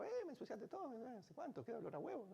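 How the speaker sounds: high, soft mock scolding mother; squeaky imitation of an annoyed mom. Girl imitating her mom scolding her over the egg, in a high squeaky voice.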